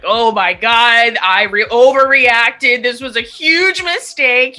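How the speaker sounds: slow, high and loud mock panic; wailing that it was all a mistake; panicked. Arriving at luxury rehab, panicking high and loud. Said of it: "I was just like".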